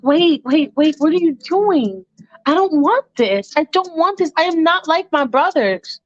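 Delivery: put-on high-pitched, pleading young man's voice; protesting Michael. Michael refuses a woman's advances, high protesting voice. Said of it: "Michael's like".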